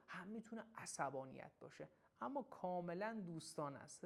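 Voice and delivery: usual speaking voice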